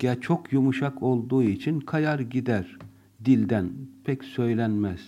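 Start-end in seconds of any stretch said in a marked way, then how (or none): none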